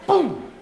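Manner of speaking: loud, high imitated blast; explosive boom. Youth speaker likens love to diving in, explosive boom. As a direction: punch it out like a burst.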